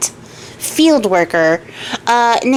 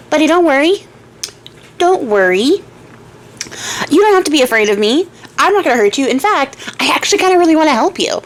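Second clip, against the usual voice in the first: lightly voiced friendly lizard, pitched higher; casual, higher-pitched reassurance from a storybook creature; reassuring lizard; 5 semitones higher than usual. Giant lizard tells scared farmer not to worry, lightly voiced reassurance.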